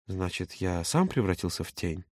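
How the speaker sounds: slow, low, soft question; hushed, slow reproach. A narrator's reading. Юра asking if he became a shadow, hushed. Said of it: "Чем тише говорил Юра".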